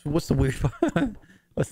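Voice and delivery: tearful chuckle at yourself; laughing through tears. Real, not acted. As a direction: laugh breaking into the words. Laughing at himself while crying.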